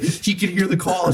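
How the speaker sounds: high, shaken, hushed; imitating shaky fear. Friend telling Xbox support he's overheard, high and shaky. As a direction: mimic a frightened friend on the phone. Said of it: "he's shaking so bad".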